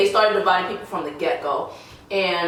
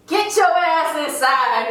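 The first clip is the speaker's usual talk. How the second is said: high, slow barked order; drill instructor yelling; 8 semitones higher than usual. Retold or imitated. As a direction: bark it like a drill sergeant.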